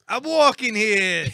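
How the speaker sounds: loud, high, drawn-out New York shout; Italian New York accent. Shown as a demonstration. Put on the spot by co-hosts, shouting a bad New York accent. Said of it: "like, Italian New York accent".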